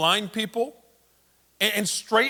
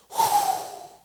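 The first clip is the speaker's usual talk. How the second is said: very high proud whew. Acted. Peter proud of forgiving.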